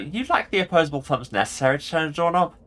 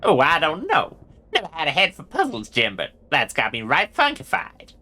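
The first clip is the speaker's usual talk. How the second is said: higher-pitched Western sidekick voice; baffled cowboy; 5 semitones higher than usual. Acted. Gabby admits no head for puzzles, puzzled cowboy voice. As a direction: play a puzzled old cowpoke.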